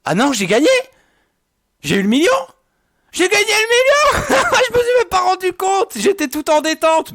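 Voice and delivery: high-pitched shrieks of joy; shrieking jackpot winner. Real, not acted. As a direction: scream it out in real delight. Wins the million in the game.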